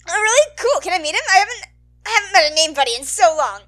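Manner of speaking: bright eager voice, fast; excited. Acted. Mike gushing to Midori about his dad's name, excited. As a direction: gush over a name buddy.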